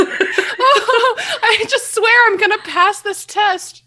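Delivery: high, slow nervous giggle. Shown as a demonstration. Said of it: "What do people get nervous about?".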